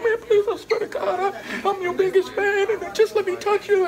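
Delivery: high-pitched mock pleading; tearful begging. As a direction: imitate an overwrought fan begging his idol. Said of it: "he was, like, crying".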